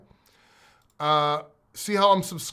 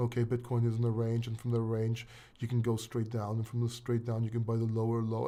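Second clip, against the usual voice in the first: lower, softer, a lifeless analyst drone; mock emotionless crypto analyst, lower and softer; mock boring chart analyst; 6 semitones lower, 6 dB quieter, faster than usual. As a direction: parody a dull trading-chart commentator, quiet and low. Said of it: "do you really wanna see somebody talking like this".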